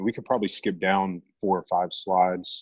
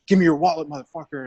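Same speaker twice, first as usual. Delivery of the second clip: sharp, loud, high threat right in the ear; menacing mugger threat; 9 semitones higher, 3 dB louder, faster than usual. Retold or imitated. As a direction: bark it like a mugger grabbing you. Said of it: "the voice, the tonality".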